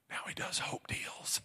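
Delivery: slow whispered punchline; hushed whisper. Retold or imitated. Little girl telling the crowd about her daddy, slow whisper. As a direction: whisper it slowly, like a secret revealed. Girl's punchline voiced in story.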